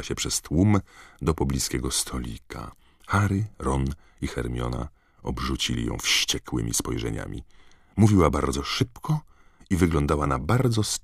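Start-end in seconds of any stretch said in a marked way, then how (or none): none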